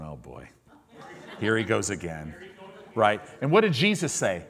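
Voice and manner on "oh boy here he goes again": low, much softer muttered sigh with a chuckle; mock the tired disciples, under your breath; weary eye-roll. James and John watching Peter show off, soft weary groan. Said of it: "James and John are just going".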